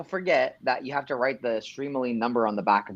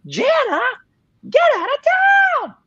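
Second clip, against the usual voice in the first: high, loud screaming [name]; 7 dB louder than usual. Retold or imitated. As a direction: scream it, pitch way up. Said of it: "higher and higher pitched until I was basically screaming".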